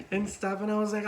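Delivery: ordinary delivery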